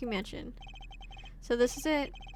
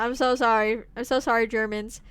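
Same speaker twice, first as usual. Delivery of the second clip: ordinary talk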